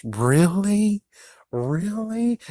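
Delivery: rising high really; incredulous. Real, not acted. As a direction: disbelief climbing up. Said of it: "disbelieving repeat".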